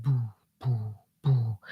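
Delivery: low soft thumping boo boo boo; heartbeat imitation. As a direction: mimic your own pounding heartbeat. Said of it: "My heart's like".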